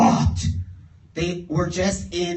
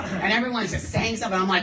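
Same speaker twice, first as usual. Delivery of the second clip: usual speaking voice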